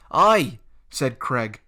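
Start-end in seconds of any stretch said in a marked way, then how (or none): 0.1-0.6 loud eager aye; jeering sidekick; bully agreeing. Acted. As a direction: chime in with the gang. Craig joining in.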